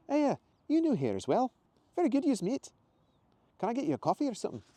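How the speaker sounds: very high Scottish woman; Scottish accent. Acted. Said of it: "much softer Scottish accent".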